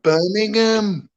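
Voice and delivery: slow, high-pitched mock Brummy; exaggerated, lilting Brummy; Brummy accent. Shown as a demonstration. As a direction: draw it out in a Birmingham accent. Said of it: "That's it".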